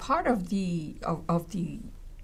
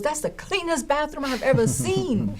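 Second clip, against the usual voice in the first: amazed student; 7 semitones higher than usual. Retold or imitated. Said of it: "he looks at me and goes".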